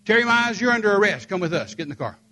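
a hint of a loud officer barking an arrest; lightly voiced police officer making an arrest; officer's arrest order. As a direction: snap it out like a cop. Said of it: "ran up to me and said".

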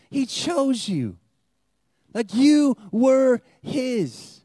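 stretched-out sermon proclamation; emphatic preaching. Acted. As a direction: press each word on the crowd.

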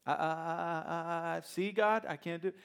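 deliberate, hammed-up stutter, high and pleading; stuttering Moses. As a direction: stammer on purpose to dodge the job. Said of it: "started stuttering on purpose".

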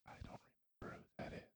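breathy whisper of a quote. Retold or imitated. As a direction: whisper it like a private confession. Acting out a forgetful moment at work.

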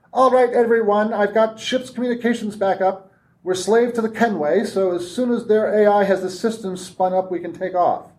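echoing over the ship speakers; like a voice from every console. A narrator's reading. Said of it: "The voice sounded, echoed strangely".

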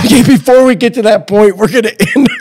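high, laughing sign-off. Real, not acted. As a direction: wrap up through laughter. Host laughing while closing.

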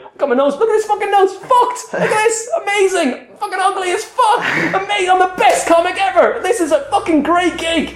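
very high, laughing mock elation; hammed-up hysterical glee over an injury; manic boasting. Acts out boasting about being hit.

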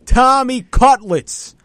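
slow, loud, high nickname shout; hyped drawn-out call. Real, not acted. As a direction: stretch the nickname like a stadium call.

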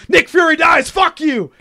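telling off a nerd in the cinema, mock shout. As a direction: yell it out, gleeful and rude. Imagined yelling at nerd in cinema.

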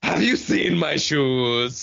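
slow, dainty put-on voice; prim and very drawn out; fussy dainty voice. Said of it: "Remind me to be that character".

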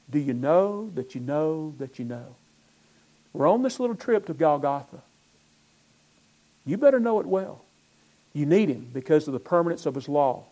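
ordinary talk

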